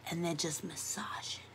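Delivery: breathy whisper, savouring it. Pretend. Savouring the massage in a whisper. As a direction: whisper it like you're melting into it.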